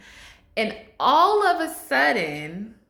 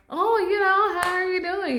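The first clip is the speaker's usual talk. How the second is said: chirpy passerby; 10 semitones higher than usual. Retold or imitated. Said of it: "they're just like".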